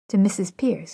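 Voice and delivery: usual speaking voice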